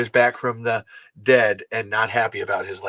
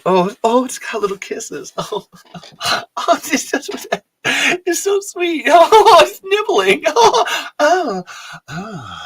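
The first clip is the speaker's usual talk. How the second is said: squealing very high, half laughing; melting over something adorable; gushing over a cute toy; 14 semitones higher, 4 dB louder, slower than usual. Real, not acted. Cooing over a graboid plush toy.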